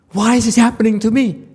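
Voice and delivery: ordinary talk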